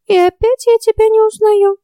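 high, puzzled little fairy voice. A narrator's reading. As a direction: voice a baffled tiny fairy. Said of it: "недоуменно проговорила Ульрика".

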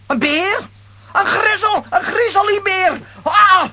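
high, loud panicked squeal rising to a scream; terrified shriek. Acted. Lambiek shrieks at the bear in the woods, terrified. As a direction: shriek in terror at the monster.

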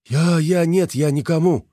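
high, frightened stammering; panicked stammer. A narrator's reading. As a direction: call back in panic. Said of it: "донеслось сверху".

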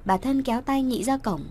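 ordinary delivery

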